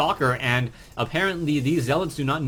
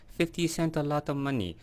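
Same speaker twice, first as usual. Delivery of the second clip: a quieter fake Hong Kong accent; 2 semitones higher, 5 dB quieter than usual. Shown as a demonstration. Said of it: "my Kanto fake Hong Kong accent".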